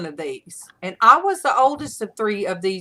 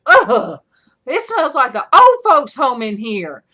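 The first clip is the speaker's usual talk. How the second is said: mock disgust; 4 semitones higher, 8 dB louder than usual. Quoting Casey's complaint.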